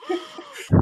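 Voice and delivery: a burst of high giggling; high laugh. Real, not acted. Host laughing at the rant, high giggle.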